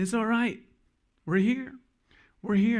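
put-on high, soothing mom reassuring her boy; comforting mother. Mom hugging her lost son, high soothing voice.

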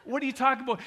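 high, loud disbelief; baffled customer. Retold or imitated. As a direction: blurt it out in acted astonishment. Acted baffled customer answering excited cashier.

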